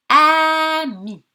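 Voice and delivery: "ami" loud and high, the a held long; stretched word. Shown as a demonstration. Teacher isolating the first syllable, "ami" loudly stretched on a. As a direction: stretch the first vowel of "ami", then a short mi. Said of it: "comment étirer les mots".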